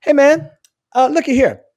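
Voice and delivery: high-pitched "hey, brother" approach; friendly confrontation. Acted. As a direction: call him over for a word. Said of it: "this is the way I would talk".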